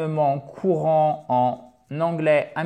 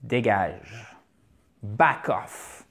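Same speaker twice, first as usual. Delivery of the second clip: low, blunt dismissal; a low-pitched rude send-off; blunt vulgar version; 6 semitones lower, 3 dB quieter, much slower than usual. Acted. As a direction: tell them to clear off, voice dropped. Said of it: "je vous le fais version un peu plus vulgaire".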